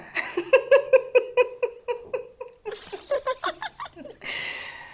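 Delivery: cracking up at the memory; big laugh. Real, not acted. Laughing about the chats.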